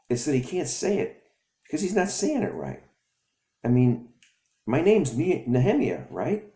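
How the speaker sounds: put-on indignant kid. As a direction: insist loudly like a kid in the right. Kid protests to dad.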